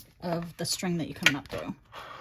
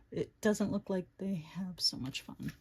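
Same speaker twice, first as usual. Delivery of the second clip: unvoiced whispered aside; hushed whisper to herself; 3 semitones lower, 6 dB quieter than usual. Real, not acted. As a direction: breathe it under your voice. Whispered aside while crafting.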